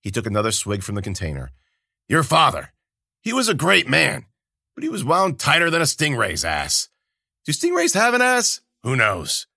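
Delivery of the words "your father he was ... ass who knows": loud, high bawdy joking; a tipsy old man ribbing his friend; booming high-pitched jester voice; jolly Kabata. A narrator's reading.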